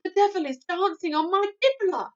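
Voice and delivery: very loud high outburst; furious anguished cry. A narrator's reading. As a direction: rage and despair together. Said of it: "his voice was filled with fury and anguish".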